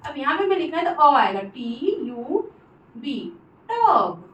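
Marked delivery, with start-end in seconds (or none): 1.4-4.3 slow spelling demo. Shown as a demonstration. Teacher spelling टब for students, slow demo. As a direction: spell T U B slowly, then टब. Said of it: "ऐसे pronounce हो रहा है ना".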